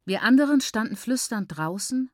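plain reading voice

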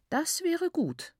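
viel höher, langsam und zustimmend; hohe, gedehnte Tantenstimme; zustimmende Tante. Acted. Tante stimmt zu. (hohe, langsame Frauenstimme)

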